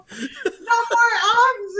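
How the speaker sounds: high, slow, laughing through the words; laughing mock yell. Scolding herself on the recording, slow and laughing. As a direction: drag it out, cracking up. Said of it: "I'm screaming at the computer".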